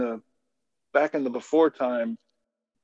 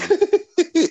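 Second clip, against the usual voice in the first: loud squealing laugh; high burst of laughter; 6 dB louder than usual. Real, not acted. Host laughing at a co-host's joke, loud squealing laugh. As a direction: crack up loudly.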